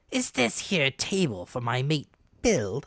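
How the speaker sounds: a sly, leering sailor's voice; leering stranger. Acted. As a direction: lean in with a knowing leer. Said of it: "he asked with a kind of leer".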